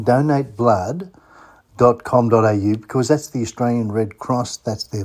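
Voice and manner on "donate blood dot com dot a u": slowed to dictation pace, donate blood drawn out; slow, for writing down. Shown as a demonstration. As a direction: spell it out slowly so listeners can note it. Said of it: "Now, write that one down".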